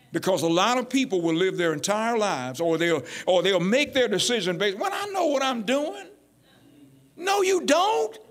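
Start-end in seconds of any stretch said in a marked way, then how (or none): 4.8-6.1 higher-pitched imitation of a know-it-all; mock self-assurance. Preacher voicing people who decide wrongly, mock self-assurance. 7.2-8.2 high, drawn-out contradiction; incredulous rebuttal. Real, not acted. Preacher answering someone who thinks they know, incredulous rebuttal. Rebuts the imagined overconfident speaker.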